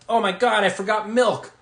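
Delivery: mock dismay. Acting out a grocery-store realization.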